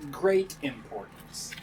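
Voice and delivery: usual speaking voice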